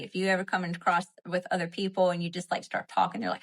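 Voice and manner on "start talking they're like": normal talking voice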